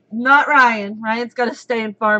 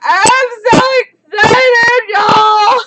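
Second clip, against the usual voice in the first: slow, drawn-out high shout; real overjoyed squealing yell; excited squeal; 11 semitones higher, 9 dB louder, much slower than usual. Real, not acted. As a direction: shriek it, stretching every word. Bursting with her big news.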